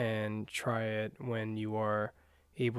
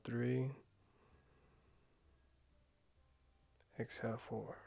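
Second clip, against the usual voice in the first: guided meditation. Acted. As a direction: ease them into calm breathing, gently.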